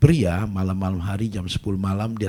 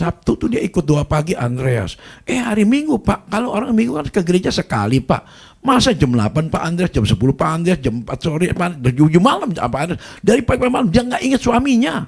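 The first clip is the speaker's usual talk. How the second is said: high, louder, piling up grievances; loud complaint; 9 semitones higher, 5 dB louder than usual. Retold or imitated. Husband complaining to the pastor about his wife, loud and high. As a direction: vent it all, loud and high.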